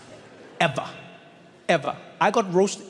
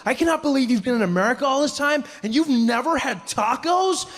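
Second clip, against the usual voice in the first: mock outrage; 7 semitones higher than usual. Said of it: "he jumped up and he was like".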